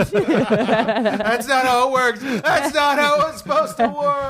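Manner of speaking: laughing out the words. Real, not acted. Laughing while protesting a joke.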